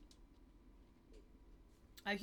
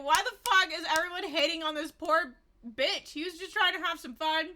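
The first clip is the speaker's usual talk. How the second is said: retold rant; 8 semitones higher, 8 dB louder than usual. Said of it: "I was like".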